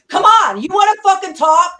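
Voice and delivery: loud, high furious challenge; angry shout. Real, not acted. Calling Leslie out to talk it out: furious shout. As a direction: yell it right at her, daring her to step up.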